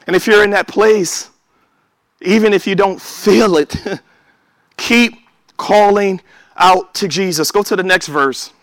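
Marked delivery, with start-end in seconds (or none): none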